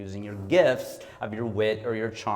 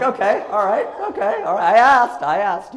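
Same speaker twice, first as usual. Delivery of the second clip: much higher and louder, laughing, slowing at the end; laughing acceptance; 10 semitones higher, 9 dB louder than usual. Retold or imitated. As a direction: take the criticism with a laugh, you asked for it. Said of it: "It was like".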